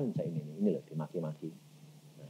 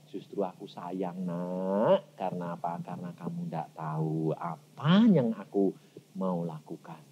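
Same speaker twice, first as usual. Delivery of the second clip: lower, softer tender reply; gentle voice of God; 4 semitones lower, 3 dB quieter than usual. Acted. God answering the weeping believer, lower and softer. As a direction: reassure the crying child softly.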